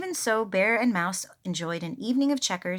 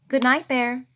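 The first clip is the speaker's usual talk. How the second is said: usual speaking voice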